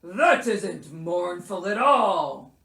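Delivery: loud, slow, indignant bear; crying out in protest. A narrator's reading. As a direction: protest loudly as an offended bear. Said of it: "cried Bear".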